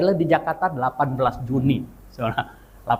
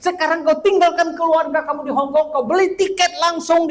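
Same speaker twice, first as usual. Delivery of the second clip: retold angry rebuke, much higher and a bit louder; 13 semitones higher, 4 dB louder than usual. Friend scolding the preacher for missing his sermon, angry. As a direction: scold him as a let-down friend. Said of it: "Dia marah sekali".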